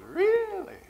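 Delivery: surprised really. Retold or imitated. Eric reacting to the confession, high surprised squeak. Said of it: "And he goes".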